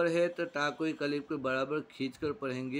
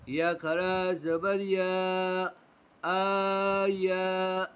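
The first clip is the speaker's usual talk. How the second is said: slow, drawn-out recitation of syllables; Quran spelling drill; 3 semitones higher, 3 dB louder, much slower than usual. Shown as a demonstration. Teacher drilling pupils in Quran spelling, slow chant. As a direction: spell it out syllable by syllable, stretching long vowels.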